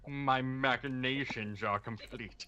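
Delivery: slow, lightly voiced sixties villain; James Bond villain voice. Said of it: "nineteen sixties James Bond villain voice".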